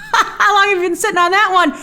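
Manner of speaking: high, laughing teasing question; amused ribbing; laughing tease. Real, not acted. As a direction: tease him through laughter. Laughing at the joke.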